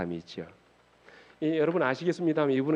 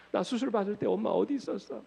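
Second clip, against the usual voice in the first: mock tearful reproach; 5 semitones higher than usual. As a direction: voice a crying boy's complaint, lightly acted. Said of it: "막 울더라는 거예요".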